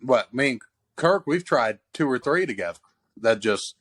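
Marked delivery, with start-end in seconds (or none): none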